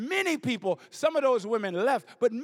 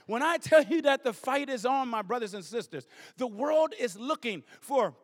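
usual speaking voice

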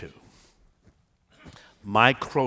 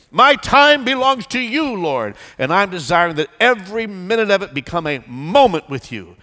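fervent declared prayer; 6 semitones higher, 9 dB louder than usual. Acted. Preacher leads the church in a loud declaring prayer. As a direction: pray it out boldly to the Lord.

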